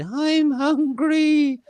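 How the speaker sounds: high, slow, squeaky little bird; hungry bird voice. Pretend. Pretend bird telling the cook it's hungry, very high and slow. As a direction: stretch it out very high like a tiny bird. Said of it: "they said".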